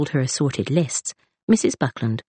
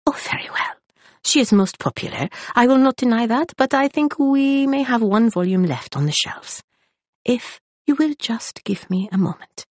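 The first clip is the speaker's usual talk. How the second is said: sighed start, then higher and obliging; resigned librarian; 9 semitones higher than usual. A narrator's reading. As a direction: give in with a sigh. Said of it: "He sighed".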